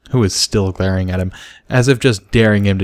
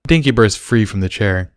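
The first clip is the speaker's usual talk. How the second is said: plain reading voice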